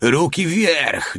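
high, drawn-out call in a put-on voice; acted yelling. A narrator's reading. Soldier chasing a fleeing Russian, high stretched yell. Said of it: "darł się za uciekającym Rosjaninem".

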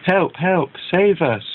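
mock panic, not really loud; cry for help. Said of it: "Loud shouts from tent number three".

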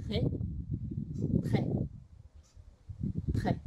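"トレ" as a short French "très"; "トレ" in one clipped syllable, no O; T straight into R. Shown as a demonstration. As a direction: release T directly into the R of "トレ". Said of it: "といった後に".

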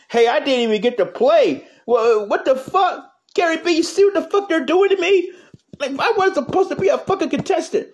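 aggrieved little character; whiny puppet. Acted. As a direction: puppet protesting to Gary B he never got to play. Puppet complains to Gary B.

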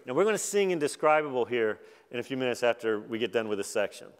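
usual speaking voice

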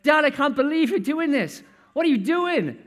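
bursting out in disbelief at his father; an angry elder son who can't believe it. Acted.

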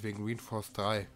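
ordinary talk, nothing marked

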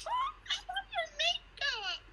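very high, thrilled squeal; starstruck squeal. Real, not acted. Fan tells idol she loves her makeup, high squeal. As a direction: gush in a high, giggly pitch.

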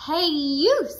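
loud, high grouchy call; grouchy shout. Acted. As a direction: yell a grumpy challenge. Ladybug challenges whale.

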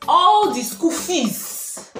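loud, rising furious parent rant; scolding Nigerian parent. Acted. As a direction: berate your child like a strict parent. Scolding parent acted in her skit.

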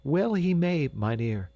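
high, excited woman's exclamation; crying out. A narrator's reading. Dame to the doctor, crying out excitedly. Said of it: "cried the dame".

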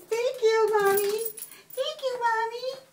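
high little put-on pet voice; voicing the pet. River thanking Mommy for the toy, high put-on voice. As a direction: speak for the animal in a high singsong.

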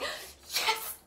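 very high, thin squeal of victory; high-pitched cheer; triumphant yes. Acted. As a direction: celebrate with an elated squeal. Triumph at finished assignment.

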